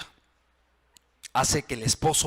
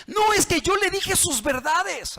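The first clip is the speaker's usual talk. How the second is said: defiant spouse; 4 dB louder than usual. Retold or imitated. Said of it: "Pero ¿cómo se las dijiste?".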